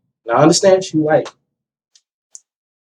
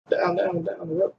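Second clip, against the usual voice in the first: muttered softer echo of the son's words; soft repeat; 3 semitones higher, 12 dB quieter than usual. Retold or imitated. Father echoing his son's words to himself, softly. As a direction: repeat it under your breath, dawning. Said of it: "repeats son's words".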